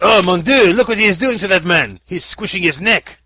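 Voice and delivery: loud mock French alarm; French accent. Frenchman watching the strangling, loud mock French. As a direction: gasp at the carnage like a Frenchman.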